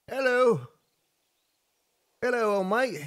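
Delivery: high-pitched British-Australian mash-up; half British, half Australian, pitched high; British-Australian accent. Pretend. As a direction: greet in a playful British-Aussie cross. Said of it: "cross between my British accent and my Australian accent".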